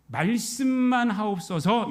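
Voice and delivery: each syllable drawn out, humbly; slow earnest plea. Acted. Centurion begging Jesus, slow earnest plea.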